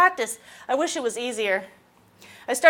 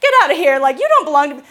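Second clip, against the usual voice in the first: loud, higher, shooing the critic away; mock self-love shout; 3 semitones higher, 7 dB louder than usual. Client defending themselves against the inner critic, loud shooing. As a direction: chase the critic off, then reassure. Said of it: "they'll be like".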